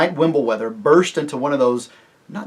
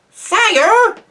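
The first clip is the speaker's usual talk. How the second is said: very high, loud little mouse cry; small creature calling out, high and piercing; shrill voice; 12 dB louder than usual. A narrator's reading. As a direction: pipe up shrilly to be noticed. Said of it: "came a shrill voice from near the ground".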